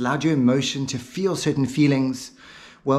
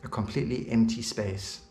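measured hypnotist voice, key words set apart by pauses; hypnotic guidance; 3 semitones lower, 5 dB quieter than usual. Real, not acted. Hypnotist preparing listener for the word imagination, calm and deliberate. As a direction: guide them calmly into an empty mental space.

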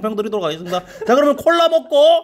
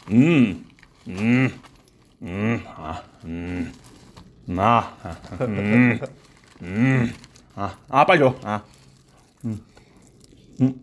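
chewing while humming; talking between bites; mouth full of chicken; 11 semitones lower, much slower than usual. Real, not acted. Seen 3: Man in glasses bites the drumstick repeatedly, lips closed between bites.

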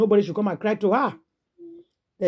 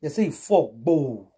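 ordinary talk, nothing marked

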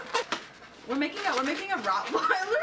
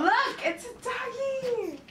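put-on baby talk; 11 semitones higher, much slower than usual. Showing off a balloon dog.

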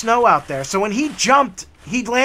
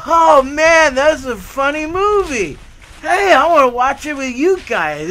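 louder, higher gush; excited; 6 semitones higher, 4 dB louder than usual. Retold or imitated. Tall guy begging to join, eagerly excited. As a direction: gush over the movie.

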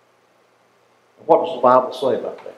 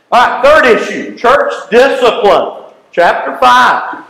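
third issue called out loud and slow; booming sermon heading; loud announcement; 7 semitones higher, 5 dB louder, slower than usual. Real, not acted. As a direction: announce the heading to the whole hall. Announcing new sermon point.

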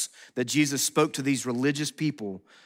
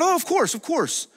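dismissive brush-off; 13 semitones higher than usual. Pretend. Listeners brushing off the gospel.

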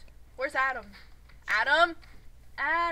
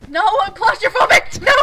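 high, loud panicked cry; panicked shout; 7 semitones higher, 13 dB louder, faster than usual. Real, not acted. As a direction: yell it in panic. Panicking, trapped in the game.

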